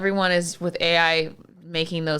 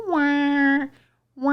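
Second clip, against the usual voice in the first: siren imitation; 8 semitones higher than usual. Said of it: "hear those sirens".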